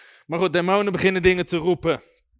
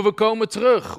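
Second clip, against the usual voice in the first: higher, louder mock demon threat; retold demon call; 5 semitones higher, 6 dB louder than usual. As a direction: call it out as a mimicked spirit. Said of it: "Of sommigen roepen".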